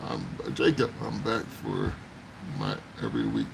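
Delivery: low, quiet reading voice; deep voice. Retold or imitated. Reading a viewer's comment aloud.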